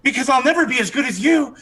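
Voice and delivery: high, swooning, pleading voice; charmed archer begging forgiveness, high and swooning; lovestruck archer. Acted. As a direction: gush the apology, smitten and breathless. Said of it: "He's like".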